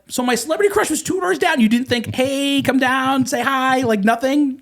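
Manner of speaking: high-pitched mock outrage; incredulous complaint. Repeating his complaint while they all laugh, high incredulous protest. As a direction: whine the grievance in disbelief.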